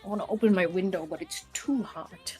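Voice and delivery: normal talking voice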